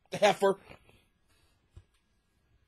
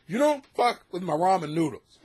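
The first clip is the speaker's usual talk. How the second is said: ordinary talk, nothing marked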